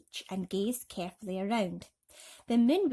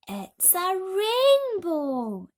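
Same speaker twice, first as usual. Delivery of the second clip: very slow, loud, much higher wonder; awed exclaim; 11 semitones higher, 10 dB louder, much slower than usual. A narrator's reading. Everyone exclaiming together at the rainbow. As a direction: gasp it out in awe. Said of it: "everyone said at once".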